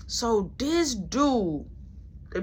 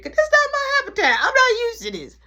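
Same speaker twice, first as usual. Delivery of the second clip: very high, loud squealed complaint; indignant protest; 7 dB louder than usual. Retold or imitated. Refusing to make do in jail, high loud protest. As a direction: protest loudly, pitch shot way up.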